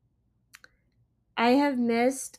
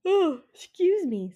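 words stretched by a big yawn; 8 semitones higher, 4 dB louder than usual. Real, not acted. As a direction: excuse me said through a yawn. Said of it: "I apologize for my yawning".

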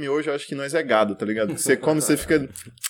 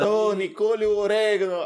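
put-on Italian accent; 6 semitones higher, slower than usual. Chef calls cook Tony.

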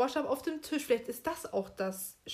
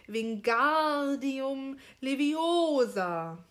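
GAR in Wingardium stretched long, stress on the O of Leviosa; drawn-out, stressed syllables; 3 semitones higher, 3 dB louder, much slower than usual. Shown as a demonstration. Host demonstrates Hermione's correct spell, stressed Wingardium Leviosa. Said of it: "das Gar schön lang und die Betonung auf dem O".

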